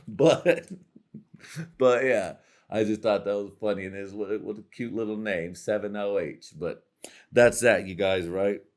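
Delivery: normal talking voice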